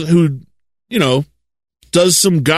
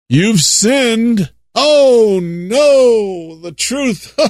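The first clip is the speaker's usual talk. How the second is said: mock shock; 5 semitones higher, much slower than usual. Mocking shocked churchgoer.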